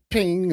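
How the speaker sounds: high cartoon ping sound; high mock ding. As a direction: squeak it like a shooting gallery bell.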